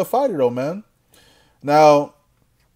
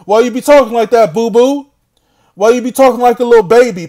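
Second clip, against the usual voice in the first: teasing; 6 semitones higher, 7 dB louder than usual. Real, not acted. Teasing rival as baby.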